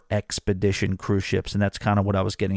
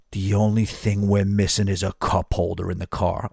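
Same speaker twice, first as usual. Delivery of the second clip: imitating a straight-faced bartender; deadpan; 3 semitones lower than usual. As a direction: deliver it dead serious, like a verdict.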